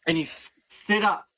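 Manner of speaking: ordinary talk, nothing marked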